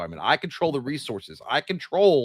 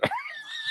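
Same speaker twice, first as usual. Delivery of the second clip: high squealing laugh; 9 dB quieter than usual. Real, not acted. Laughs at awkward topic.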